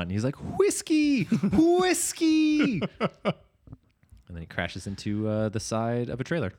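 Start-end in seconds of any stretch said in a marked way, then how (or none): 0.6-2.8 very high-pitched mock cry of "hwiskey"; drawn-out high "HWISKEY" cries; stuntman yelling safe word. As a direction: imitate a panicked safe-word call, way up high. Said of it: "tries to do this stunt".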